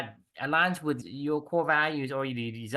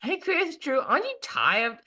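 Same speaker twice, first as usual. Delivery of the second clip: imitating people; 8 semitones higher than usual. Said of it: "people can say".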